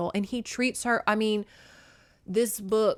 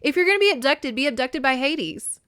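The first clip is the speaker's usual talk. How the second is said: higher, louder smug quip; cocky Hades; 6 semitones higher, 5 dB louder than usual. Retold or imitated. Hades teases Persephone about her kidnapping, higher and smug. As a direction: brag with a grin. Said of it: "he's like".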